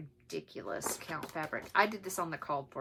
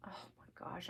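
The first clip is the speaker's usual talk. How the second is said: whispered excitement; 13 dB quieter, faster than usual. Real, not acted. Excited about finishing.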